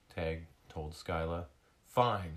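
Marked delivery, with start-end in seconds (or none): 1.9-2.3 louder, huffed concession; huffy. Acted. Skyla giving in to Tag, huffy. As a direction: give in with a huff. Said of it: "Skyla huffed".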